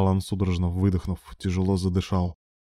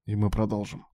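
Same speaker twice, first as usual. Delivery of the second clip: softly hoarse, worn out; 5 dB quieter than usual. A narrator's reading. Ilya, spent, talks to his lover, hoarse. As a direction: say it quietly with a husky throat. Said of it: "произнёс он хриплым голосом".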